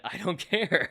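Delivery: slow, laughing through the words; chuckling shrug. Real, not acted. Host defending fan service, laughing it off.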